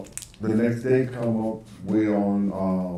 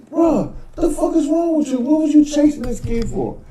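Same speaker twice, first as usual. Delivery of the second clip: high, loud disbelieving question; incredulous exclaim; 4 dB louder than usual. Retold or imitated. Speaker catching up to Puff after the chase, high and incredulous. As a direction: demand an explanation, stunned.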